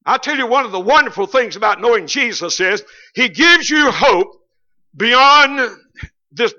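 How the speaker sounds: loud, high preacher's proclamation; full-force preaching. Acted. Preacher promising hope beyond death, loud and high.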